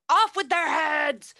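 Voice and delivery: hammed-up royal yell; mock royal shout. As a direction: shout the order like the Queen of Hearts. Queen shouting in retold story.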